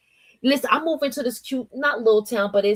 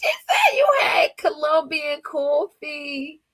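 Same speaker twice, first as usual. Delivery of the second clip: slow, high, laugh-strained words; reading through laughter; 6 semitones higher, slower than usual. Real, not acted. Streamer repeating a viewer's coffee joke, laughing. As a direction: squeeze the words out slowly after cracking up.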